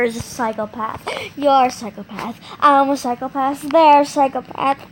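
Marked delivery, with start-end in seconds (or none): none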